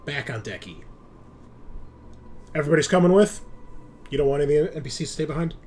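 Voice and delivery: ordinary talk, nothing marked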